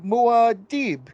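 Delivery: high-pitched imitated war cry; mock yelling. Fan mimics warriors straight up yelling, high mock yell. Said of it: "straight up yelling".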